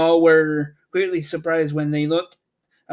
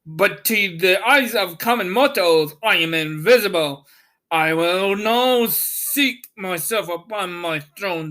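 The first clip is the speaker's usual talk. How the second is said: Oz declaring; 3 semitones higher than usual. A narrator's reading. The invisible wizard telling the travelers he will sit, lofty. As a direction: speak down to common mortals. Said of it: "answered the voice".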